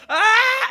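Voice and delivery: put-on high, loud kid scream; child's terrified scream. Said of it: "and Chimney's like".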